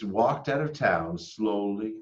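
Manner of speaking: plain reading voice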